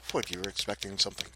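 plain reading voice

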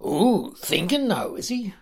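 high, sneering orc taunt; mock him with a rising sneer. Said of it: "Mocked Rabjag".

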